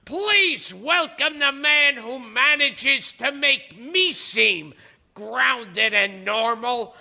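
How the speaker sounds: emphatic, punched show-host read; talk-show intro, each credit hit hard; announcer introduction. Acted. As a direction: announce the guest with big stressed names. Host reads the guest introduction.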